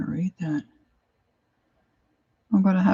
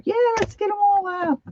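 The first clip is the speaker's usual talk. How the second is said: high-pitched excited squeal of words; playful excitement. Real, not acted. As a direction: cheer it out in a much higher voice. Delighted by cute stamps, playful outburst.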